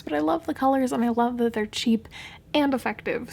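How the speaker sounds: usual speaking voice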